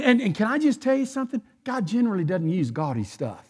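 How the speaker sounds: usual speaking voice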